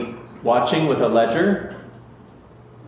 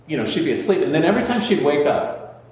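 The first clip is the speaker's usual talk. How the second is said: normal talking voice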